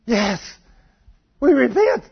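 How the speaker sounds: loud, high answer to God; urgent acted repentance. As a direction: answer fast and desperate, pitch leaping up.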